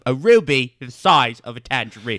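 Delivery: hammed-up Cockney storyteller; Michael Caine. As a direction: tell it in a mock British film-star accent. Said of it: "Your one accent is Michael Caine".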